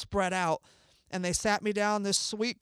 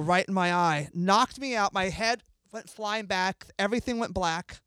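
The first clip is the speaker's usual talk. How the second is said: usual speaking voice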